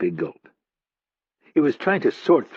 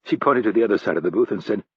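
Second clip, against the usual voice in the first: plain reading voice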